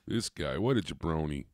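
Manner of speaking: ordinary talk, nothing marked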